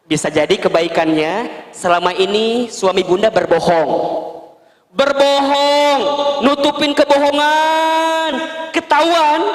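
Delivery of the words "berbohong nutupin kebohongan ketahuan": high, drawn-out cry of disbelief; shocked outburst. Retold or imitated. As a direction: stretch it out in disbelief. Ustadz mimics wife's outrage. (seruan kaget yang panjang dan tinggi)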